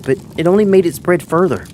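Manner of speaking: ordinary delivery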